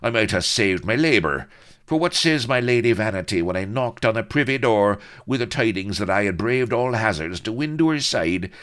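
high, loud, sustained grievance; bitter tirade. A narrator's reading. Blood complaining of Lady Vanity's ingratitude, bitterly loud. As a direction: build an aggrieved rant. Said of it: "Blood went on bitterly".

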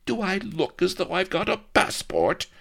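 high, haughty noblewoman's comeback; indignant retort. A narrator's reading. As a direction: throw the question back with disdain. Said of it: "retorted Vanity".

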